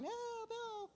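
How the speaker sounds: high hammed-up cries for help; mock stormtrooper cries. Stormtroopers being clubbed by Ewoks, high mock cries. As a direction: squeal it like a beaten soldier. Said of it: "the guys were going".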